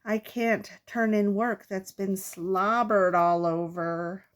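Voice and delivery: ordinary delivery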